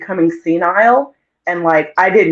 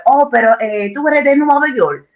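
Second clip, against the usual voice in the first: a touch of a Dominican local's voice, higher; imitating locals; 6 semitones higher than usual. Locals in the DR assume she's from New York, light mimicry. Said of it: "they're like".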